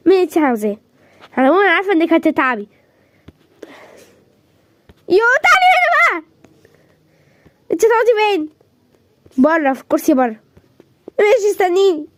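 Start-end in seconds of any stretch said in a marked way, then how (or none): 5.1-6.2 calling out. Acted. Calling her over.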